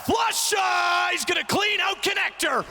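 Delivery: high hyped shouting; excited shout. Real, not acted. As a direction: scream it as the play lands. Caster hypes clutch play.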